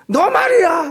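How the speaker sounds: imitating a furious driver shouting; mock angry yell. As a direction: play the hothead jumping out of the car. Mimics angry driver after crash.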